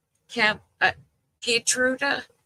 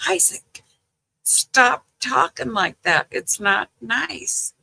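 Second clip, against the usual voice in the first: higher-pitched, drawn-out grandma reprimand; retold exasperated scolding; 6 semitones higher than usual. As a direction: imitate telling off a grandchild, pitch raised.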